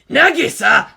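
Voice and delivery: loud, startled cry of a name; panicked yelp; near shriek. A narrator's reading. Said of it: "His voice was almost a shriek".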